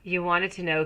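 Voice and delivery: normal talking voice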